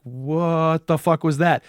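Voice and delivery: slow, uneasy question; spooked. Acted. Boys spooked by something in the background, slow and uneasy. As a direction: drag it out, unnerved.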